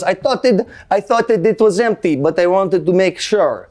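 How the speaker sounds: high mock Swedish accent. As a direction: explain yourself like a sheepish Swedish kid. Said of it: "that's the best Swedish accent I got".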